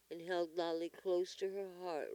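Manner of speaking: ordinary delivery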